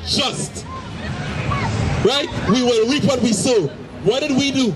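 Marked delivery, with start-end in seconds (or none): none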